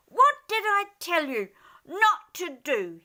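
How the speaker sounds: high, loud angry yell. Acted. Dormouse yelling at the rabbits, angry. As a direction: scold them hard like a furious dormouse. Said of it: "he yelled at the rabbits".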